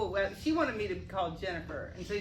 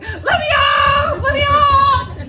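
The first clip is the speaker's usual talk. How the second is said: high, very loud drawn-out scream; desperate shouted plea, stretched out long; panicked yell; 19 dB louder, much slower than usual. Acted. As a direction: scream it out like a trapped rider begging to get off. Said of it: "you're like".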